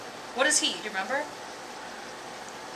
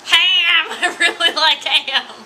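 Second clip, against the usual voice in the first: loud, higher mock craving; excited fish-girl; 6 semitones higher, 6 dB louder, slower than usual. Fish-girl to the kid about ham, hammed-up delight. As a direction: blurt it like a food-obsessed little creature. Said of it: "And she's like".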